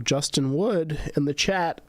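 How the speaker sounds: real voice slip, pitched high; drawn out, voice getting away upward; voice slipping. Real, not acted. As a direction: let the voice slip upward mid-announcement. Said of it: "Holy shit, my voice".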